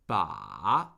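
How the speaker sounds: Ba dipping very low, then rising; third tone on Ba. Shown as a demonstration. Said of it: "The third tone is a falling rising tone".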